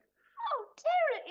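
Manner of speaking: very high, weepy cry; high-pitched sobbing lament; tearful wail. Acted. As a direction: wail it, overcome by tears. Mother breaks into tears at news.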